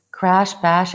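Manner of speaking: plain reading voice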